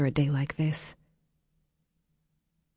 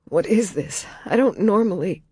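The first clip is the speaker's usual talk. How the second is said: tearful; 5 semitones higher, 4 dB louder than usual. A narrator's reading. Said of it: "she got out, even as she cried".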